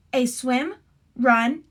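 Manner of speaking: ordinary talk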